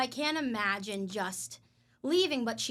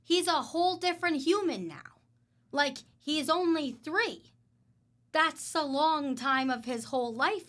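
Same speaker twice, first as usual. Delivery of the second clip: indignant; 5 semitones higher, 5 dB louder, slower than usual. Real, not acted. Indignant about lost time.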